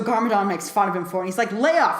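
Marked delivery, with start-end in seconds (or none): 1.5-2.0 louder, higher retort; mock protest. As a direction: push back at dad with a raised voice. Lloyd snaps back at his dad.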